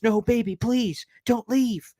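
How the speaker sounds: high, begging whine; mock desperate plea through an intercom; pleading into intercom. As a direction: beg her to stay, voice climbing high. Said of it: "runs over to the intercom and goes".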